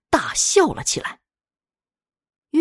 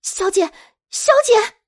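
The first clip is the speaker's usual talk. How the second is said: high-pitched urgent call, second one higher; alarmed cry; 5 dB louder than usual. Acted. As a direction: maids calling out in alarm to their fallen mistress. Maids cry out as she falls.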